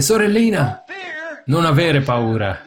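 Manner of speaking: high-pitched call on "Sorellina", easing down; mild mock shout to a little sister; retold calling out. Little brother to scared sister in the dark, retold calling out. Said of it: "Suo fratellino gridò forte".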